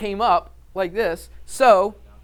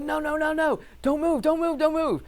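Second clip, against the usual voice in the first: retold yell; 12 semitones higher than usual. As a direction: shout it sharply up the cliff to stop him moving. Instructor shouting warning again.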